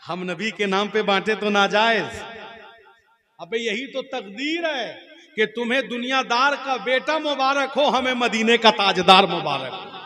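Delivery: very loud, high oratorical surge; triumphant retort. Acted. Sunni answers the cleric's objection, loud and triumphant. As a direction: fire it back at full preaching force.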